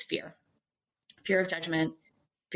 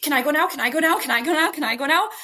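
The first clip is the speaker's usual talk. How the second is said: put-on higher, louder child nagging over and over; whiny toddler; 7 semitones higher, 5 dB louder, faster than usual. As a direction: pester like an impatient two-year-old. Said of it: "our two-year-old is like".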